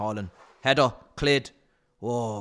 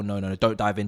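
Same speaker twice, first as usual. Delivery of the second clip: usual speaking voice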